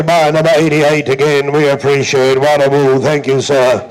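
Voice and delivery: normal talking voice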